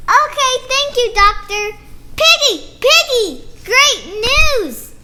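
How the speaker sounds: lively picture-book read-aloud with character voices; reading to children. Acted. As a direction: read it like story time for little kids.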